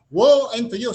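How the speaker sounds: booming warning cry; pronouncing doom loudly; loud proclamation. Retold or imitated. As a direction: thunder out the woe. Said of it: "sabi niya".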